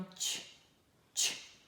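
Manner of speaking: isolated soft "tch" bursts; demonstrated tch sound. Shown as a demonstration. Teacher showing a different T sound, soft "tch". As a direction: release "tch" twice, no vowel. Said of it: "é um som diferente aqui".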